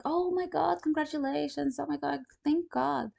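retold happy gush; overjoyed relief. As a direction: squeal with delighted relief. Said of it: "I was like".

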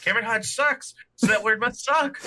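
naive robot. Retold or imitated. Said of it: "And be like".

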